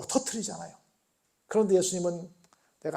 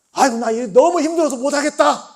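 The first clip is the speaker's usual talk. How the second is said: giving up; 6 semitones higher, 7 dB louder than usual. Acted. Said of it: "라고 포기할 만도 한데".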